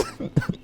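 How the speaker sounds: very high squeal of laughter; shrieking laugh. Real, not acted. Laughs at admitting five times.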